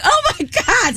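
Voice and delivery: high exasperated oh my god; disbelief. Retold or imitated. Retelling the exterminator's refusal, squealing in disbelief.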